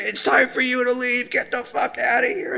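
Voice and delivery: high, strained angry telling-off; mock angry friend ordering him out. Angry friend voiced in story.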